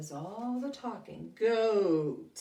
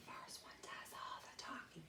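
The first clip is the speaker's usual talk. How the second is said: whisper; 19 dB quieter than usual. Shown as a demonstration. Teacher whispers rhyme to class.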